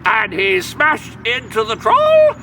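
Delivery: usual speaking voice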